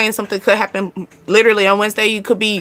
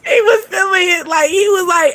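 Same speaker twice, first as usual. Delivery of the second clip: laughing; 6 semitones higher than usual. Real, not acted. Laughing through the retelling.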